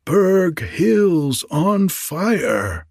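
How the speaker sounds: high, very drawn-out storybook call; crying out. A narrator's reading. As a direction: stretch each word into a long, high cry. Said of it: "cried out three times as loud as she".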